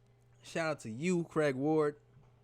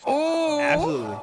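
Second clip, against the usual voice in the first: excited shout; 11 semitones higher, 8 dB louder than usual. Real, not acted. Host loudly agrees with the caller.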